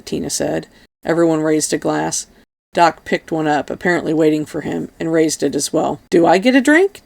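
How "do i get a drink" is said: cheeky higher-pitched question; playful asking, lifting in pitch; mischievous. A narrator's reading. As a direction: ask it with a mischievous grin. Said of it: "asked with a mischievous grin".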